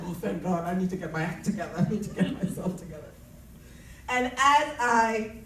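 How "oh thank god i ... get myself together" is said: quick relieved rush, breaking into a laugh. Retold or imitated. At the seminar break, telling herself to regroup, a fast relieved rush. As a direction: blurt it out fast, thankful to escape.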